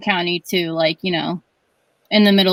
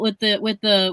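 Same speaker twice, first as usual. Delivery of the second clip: ordinary talk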